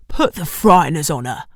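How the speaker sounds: gravelly London gangster growl; menacing cockney hardman. Acted. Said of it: "gravelly Ray Winston-esque gangster voice".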